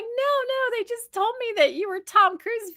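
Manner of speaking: high-pitched, hasty denial; retold reassurance. Woman explaining to the kids' father, high-pitched reassurance. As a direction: wave it off in a high voice.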